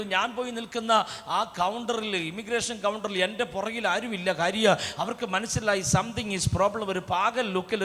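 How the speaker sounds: ordinary talk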